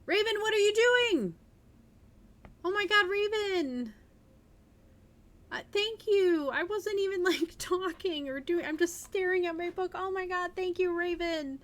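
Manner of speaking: real overjoyed streamer; excited. Real, not acted. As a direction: squeal with real delight at the gift. Streamer thanks Raven for a gift.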